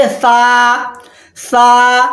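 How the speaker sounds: सा slowly stretched; drawn-out saa. Shown as a demonstration. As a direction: drag सा out one alif. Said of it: "एक अलिफ के बराबर घसीट के बोलेंगे". (सा को घसीटकर)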